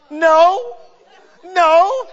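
loud, high laughing no. Real, not acted. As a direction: laugh out the denial. Laughing at Elkanah's line.

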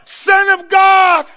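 high, loud, slow cry; demons shrieking. Acted. Preacher voicing Legion's demons before Jesus, shrieking. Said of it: "a legion of demons shrieking".

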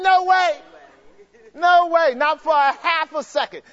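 high, loud No way, flung out twice; shouted denial. Real, not acted. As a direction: shout it to the congregation like a verdict. Preacher answers crowd, shouting.

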